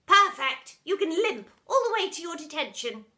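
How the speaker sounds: very loud, savage retort; cruel teacher. Acted. Miss Seeth sentencing pupil, cruel and loud. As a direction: snap it back with glee.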